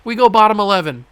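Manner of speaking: loud, high, triumphant yelling at the screen; excited shouting. Real, not acted. Gamer celebrating a clutch strikeout, excited shouting. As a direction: whoop it out like you just won. Big strikeout in extra innings.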